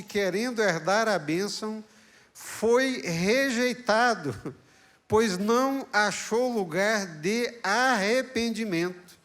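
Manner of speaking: slow, high, loud verse reading; slow preached reading. Acted. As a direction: draw out every word of the verse. (leitura lenta e solene do versículo)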